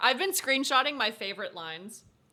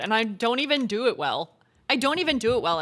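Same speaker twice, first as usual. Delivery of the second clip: ordinary delivery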